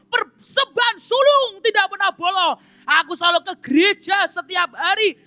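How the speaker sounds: high, loud boastful complaint to God; self-righteous rant. Acted. Man rants at God about his tithes, high loud boasting. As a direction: list your good deeds in outrage. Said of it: "mulai berkata".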